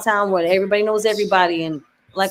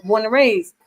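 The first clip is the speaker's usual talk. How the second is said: ordinary talk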